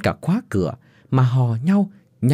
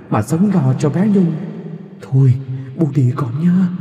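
ghost voice. Acted. Dead mother-in-law's ghost bidding her daughter-in-law farewell. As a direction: speak as a ghost saying farewell. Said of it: "giọng cụ Nhàn vọng vào tai bà".

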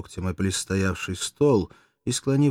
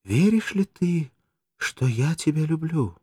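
higher, slow, coaxing; insinuating voice; 8 semitones higher, slower than usual. Acted. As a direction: coax him gently. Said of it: "вкрадчивым голосом".

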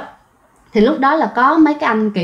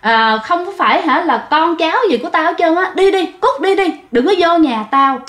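high retold angry yell; stubborn old man shouting at daughter and son-in-law, high and angry; grandfather chasing them off; 7 semitones higher than usual. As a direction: drive them off the porch, pitch up. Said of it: "Cầm cái cây đứng kêu đuổi".

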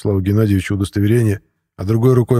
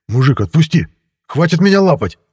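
mildly bewildered, raised plea; 6 semitones higher than usual. A narrator's reading. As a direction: protest in bafflement, rising higher at the end. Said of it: "полный недоумения". (недоумённо, голос повышается)

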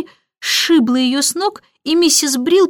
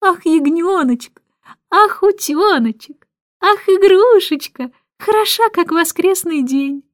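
put-on cooing over a baby; 7 semitones higher than usual. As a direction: coo at the baby, much higher. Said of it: "проворковала она".

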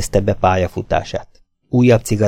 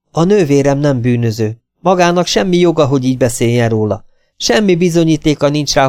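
put-on higher, heated young woman defending her sister; indignant sister; 7 semitones higher, 4 dB louder than usual. A narrator's reading. Lila telling the detective her sister is no criminal, higher and indignant. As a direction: push back hard, offended.

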